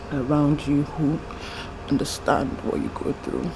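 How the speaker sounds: real tears, barely getting the words out; crying. Real, not acted. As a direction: voice dropping away as she cries. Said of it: "I am so embarrassed to be crying".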